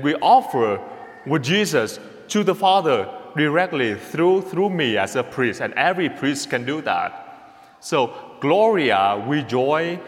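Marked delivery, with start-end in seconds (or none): none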